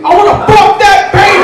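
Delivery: very loud, high-pitched holler; shouting inmate. Retold or imitated. Cut to grown man shouting at the child, high full yell. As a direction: scream it as a crazed heckler. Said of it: "a grown man shouting to his face".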